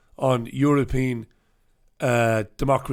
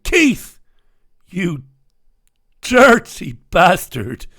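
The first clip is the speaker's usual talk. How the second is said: hammed-up yell, much higher and louder on Keith; mock outrage; 4 semitones higher, 8 dB louder, much slower than usual. Host scolding the shamed MP in mock outrage. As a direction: play a mock scandalised outburst.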